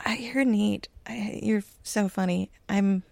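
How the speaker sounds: nervous fan whispering; starstruck whisper. Retold or imitated. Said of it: "I went up to him like".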